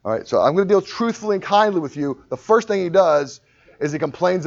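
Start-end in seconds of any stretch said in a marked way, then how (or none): none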